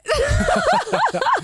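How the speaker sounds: loud, high burst of laughter; real, helpless high laughing fit; high-pitched laugh. Real, not acted. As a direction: crack up loudly and high. Reacting to the frosting confession.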